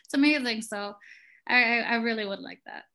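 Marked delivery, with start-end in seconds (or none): 0.1-0.6 much higher, gushing thrilled husband; excited husband. Retold or imitated. Wife quoting her husband's excited reaction to the podcast, gushing and high. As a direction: squeal it out like a proud, excited husband. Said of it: "He's like".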